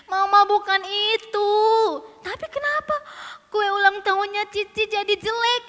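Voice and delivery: put-on high, whiny child complaint; tearful shouting. Sister explaining the spoiled cake to Mama, whiny and tearful. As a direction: wail it to your mother. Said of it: "teriak-teriak sambil menangis".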